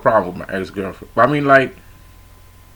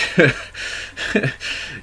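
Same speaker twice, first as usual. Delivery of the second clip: high laugh; 7 semitones higher, 3 dB quieter than usual. Real, not acted. Laughing at his own confession.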